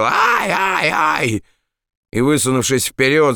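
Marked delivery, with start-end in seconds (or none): 0.0-1.4 put-on high-pitched drunken wail of "ай"; drunken yell. A narrator's reading. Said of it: "завопил". (пьяный вопль)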